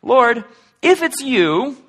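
high, loud call from the boat; Peter calling out. Acted. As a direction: call out to Jesus across the storm. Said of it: "Peter speaks up from the boat".

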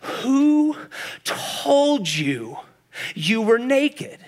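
slow, halting question with long pauses; heartbroken God. Acted. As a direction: ask it hurt, word by word. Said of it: "the broken heart of God".

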